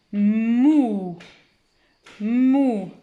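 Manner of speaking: "Mou" read loud as "mmmouuu"; blended syllable. Shown as a demonstration. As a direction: stretch hum and vowel of "Mou" into one sound. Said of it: "par le son de la première lettre". (« Mou » lié, très étiré)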